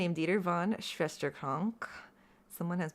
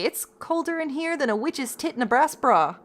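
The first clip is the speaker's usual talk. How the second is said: a hint of an old Southern grandma, higher and louder; grandma's saying; 7 semitones higher, 4 dB louder than usual. Remembering grandma's Southern phrase, lightly imitated. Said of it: "My grandma used to say".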